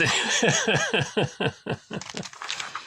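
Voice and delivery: amused laugh. Real, not acted. Laughing after own demo.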